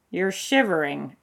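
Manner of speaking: plain reading voice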